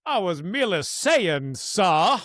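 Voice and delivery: hammed-up Southern gentleman protest. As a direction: put on a genteel Southern accent. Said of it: "this Southern gentleman type voice".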